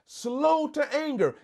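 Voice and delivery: high, slow reading. Acted. Congregation fills blanks.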